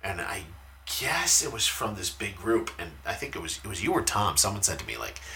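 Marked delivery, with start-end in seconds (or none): none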